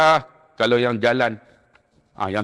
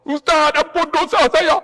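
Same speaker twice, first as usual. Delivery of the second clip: high, loud imploring cry; old man begging the ustaz to forgive his sins; pleading pilgrim; 14 semitones higher, 3 dB louder than usual. Retold or imitated. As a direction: beg the ustaz for forgiveness, voice pitched up. Pilgrim begs forgiveness again.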